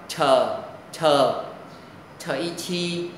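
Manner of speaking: "chờ" with a strong burst of air, then "chi"; aspirated sound. Shown as a demonstration. Teacher spells out the hard sound for students, aspirated sound. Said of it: "âm hơi gió bật hơi".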